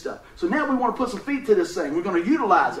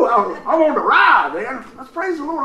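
ordinary delivery

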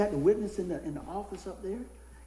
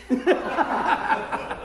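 bursts out laughing; real laugh; 10 semitones higher, 7 dB louder than usual. Real, not acted. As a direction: laugh through the word. Laughs at own joke.

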